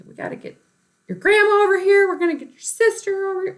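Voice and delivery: high, loud and rushed, fast; urgent family alarm. Retold or imitated. Parents calling the family over, high rushed alarm. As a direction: rattle it off like it's an emergency. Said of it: "family being called in".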